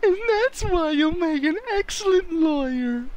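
high put-on mom voice; cheerful mom missing the point; silly mismatched redub.

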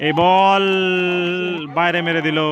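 excited call. Real, not acted. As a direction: call out the miss. Commentator reacts to a missed shot.

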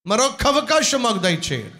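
earnest pleading prayer, voice swelling on the appeals; pastor's prayer. Acted. Pastor asks God's forgiveness for the congregation, pleading prayer.

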